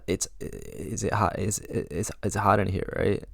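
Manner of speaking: halting, stammered attempt at small talk; hammed-up nervous fumbling with false starts; terribly awkward. Shown as a demonstration. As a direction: stumble over the opening words, drawn out and unsure. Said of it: "a terrible way".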